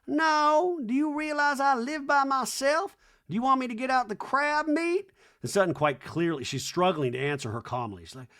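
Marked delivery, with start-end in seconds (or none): 0.1-5.1 high, slow hammed-up Reba; lofty slow mock-mom rant. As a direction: drone it like a grand old dame. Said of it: "And Reba's like".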